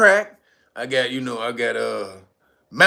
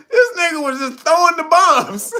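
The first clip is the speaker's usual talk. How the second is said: real laughter carrying the line; laughing; 10 semitones higher than usual. Real, not acted. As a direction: push the words out between laughs. Still laughing at the clip.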